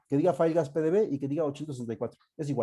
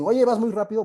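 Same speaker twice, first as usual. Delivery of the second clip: student calling out a complaint, higher and louder; complaining student; 8 semitones higher, 7 dB louder than usual. Acted. Student interrupting the teacher for going too fast, raised-voice complaint. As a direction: pipe up like a student in class. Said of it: "Si ustedes dicen".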